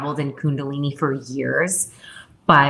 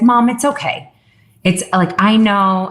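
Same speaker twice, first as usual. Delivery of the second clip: higher-pitched imitation of a child reassuring; lightly voiced young son; 5 semitones higher, 3 dB louder than usual. Six-year-old son telling mom it's okay, reassuring.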